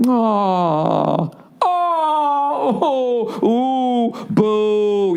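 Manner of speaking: high, drawn-out pitying crowd noises; mock crowd groans. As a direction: imitate a sympathetic benefit crowd groaning and booing. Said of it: "Those are the sounds I usually hear".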